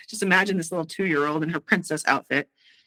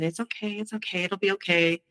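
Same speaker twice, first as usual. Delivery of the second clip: put-on hushed, gentle reassurance to a toddler; calm the crying little girl softly; soothing a child; 4 dB quieter than usual. Mother hushing her screaming toddler, softly soothing.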